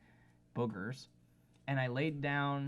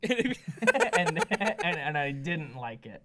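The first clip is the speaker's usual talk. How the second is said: high, loud laugh breaking into the words; 3 semitones higher, 7 dB louder, much slower than usual. Real, not acted. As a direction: talk through real laughter. Laughing while finishing own anecdote.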